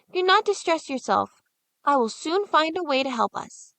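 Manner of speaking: a brave little brother soothing; comforting boy. Acted. Hansel comforting Gretel, boyish and reassuring.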